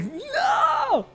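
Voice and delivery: very high, drawn-out mock scream; hammed-up cry of dismay; panicked cry. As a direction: wail it in comic horror. Re-enacts panicked cry on stage.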